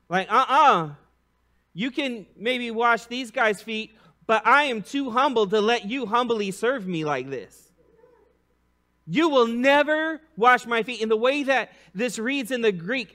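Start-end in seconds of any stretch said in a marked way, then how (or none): 0.1-0.9 loud, dismissive uh-uh; firm refusal. Retold or imitated. Preacher voicing Peter's indignant refusal. 1.7-11.0 higher, insistent, building to a loud never; indignant refusal. Retold or imitated. Preacher playing Peter refusing Jesus, indignant.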